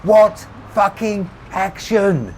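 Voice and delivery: high, slow yell, word by word; top-of-voice shout. A narrator's reading. Rebecca repeating to Tommy, out of patience, shouting full voice. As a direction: bellow each word separately. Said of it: "she shouted back at the top of her voice".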